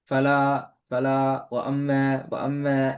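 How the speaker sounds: ஃபலா and வ அம்ம each restarted, mimicking a halting reciter; imitated repetition. Teacher mimicking the student's repeats, restarting each word. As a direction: say Falah twice, then வ அம்ம twice, as false starts. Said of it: "அந்த repeat ஆகிறது வரக்கூடாது".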